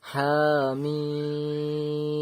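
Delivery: হা, then a very long level মিম; prolonged madd. Shown as a demonstration. Teacher repeats the same reading, মিম prolonged. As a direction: hold the vowel of মিম steadily after a shorter হা. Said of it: "মিমকে টান দিয়ে বলবো".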